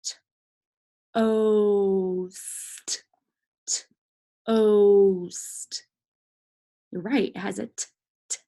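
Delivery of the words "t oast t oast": "toast" as t, then a long drawn-out "oast"; sounding out. Shown as a demonstration. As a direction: break "toast" after the t and hold the O. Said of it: "what does toast sound like".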